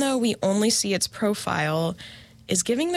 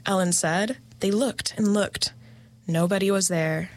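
measured storytelling with suspenseful pauses; scary story narration. Acted. Host reads a favorite creepy story to listeners, suspenseful narration. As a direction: read it like a campfire tale, letting each beat hang.

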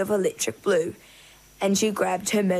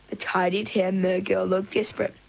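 ordinary delivery, nothing marked

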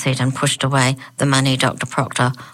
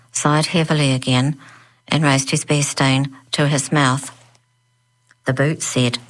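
ordinary delivery

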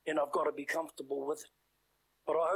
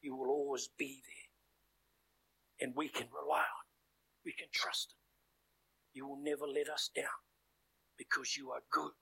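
soft, hushed prayer voice, dropping much softer; closing prayer; 3 dB quieter than usual. Acted. Preacher praying over the saints, soft and hushed.

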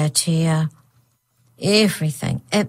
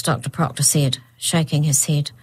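ordinary delivery, nothing marked

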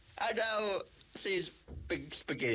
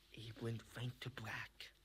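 whispering; 6 semitones lower, 12 dB quieter than usual. Acted. Fading out whisper.

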